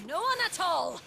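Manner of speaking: high, loud triumphant cry; gloating shout. Acted. Villain gloats, voice rising.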